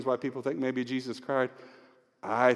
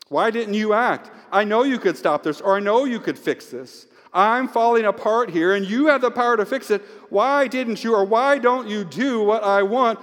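desperate pleading; 8 semitones higher, 6 dB louder than usual. Acted. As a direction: demand answers from God as you fall apart. Acted desperate prayer to the Lord.

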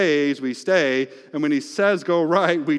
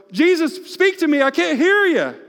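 much higher and louder, whiny; hammed-up complaint to Jesus; mock demanding prayer; mock pleading self; 8 dB louder than usual.

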